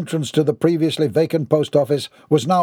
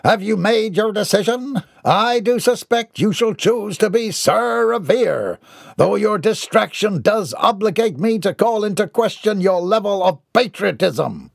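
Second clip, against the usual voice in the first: high-pitched pompous oratory; grandiose Mortimer; 7 semitones higher than usual. A narrator's reading. Mortimer pleads his cause at the counter, grandiose. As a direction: declaim as if the country depends on it.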